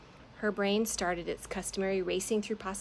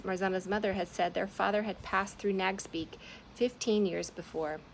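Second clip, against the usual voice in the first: ordinary delivery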